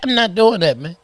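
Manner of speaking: high, mocking imitation; squeaky put-on voice. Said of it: "speak to me in the baby voice".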